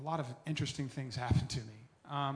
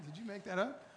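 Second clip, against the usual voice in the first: laughing through the question, pitched up; laughing disbelief; 6 semitones higher than usual. Retold or imitated. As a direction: ask it again, cracking up. Laughs while repeating the question.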